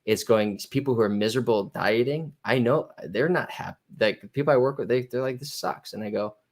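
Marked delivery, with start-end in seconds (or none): 5.4-5.9 breathy whisper of a fed-up client; whispered, fed-up grumble; whispered complaint. Retold or imitated. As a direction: mutter it under your breath, defeated. Client complaining about dieting.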